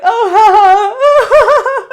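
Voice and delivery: loud, high, drawn-out mock laughter; retold awkward laugh. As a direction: overdo a big laugh at a joke you missed. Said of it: "I'm just laughing for the sake of laughing".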